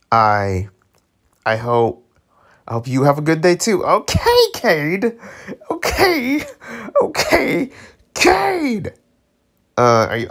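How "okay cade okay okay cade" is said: very high-pitched teasing cheer; hyped reaction. Real, not acted. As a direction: squeal it in mock-impressed falsetto. Cheering on the tongue-tied character.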